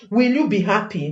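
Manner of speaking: ordinary talk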